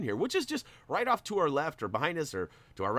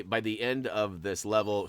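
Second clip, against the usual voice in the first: ordinary talk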